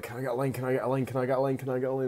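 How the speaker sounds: repetitive, insistent begging; mock pestering. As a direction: imitate people nagging over and over. Voices people pestering for a line.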